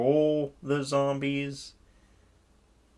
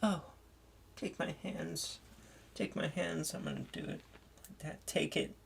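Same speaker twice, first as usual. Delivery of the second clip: higher and much softer, almost a whisper in places; mock mom voice; 5 semitones higher, 11 dB quieter than usual. Mom coaxing the pregnant zombie, high hushed mock voice. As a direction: coax it gently in a high hushed voice. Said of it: "It's like".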